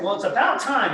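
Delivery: loud, high, pleased scold; mock relieved cheer. To the officer, loud and cheery about finally getting caught. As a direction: tease the officer for being late, loudly.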